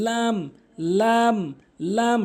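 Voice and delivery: "লাম" with a long held aa; correct pronunciation. Shown as a demonstration. Teacher models the Arabic letter, long "লাম". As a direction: draw out "লাম" evenly. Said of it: "সঠিক উচ্চারণ".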